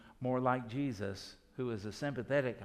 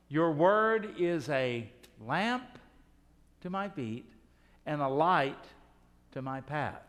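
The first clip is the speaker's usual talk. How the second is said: reciting scripture; 4 dB louder, slower than usual. Acted. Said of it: "quoting the psalm slowly".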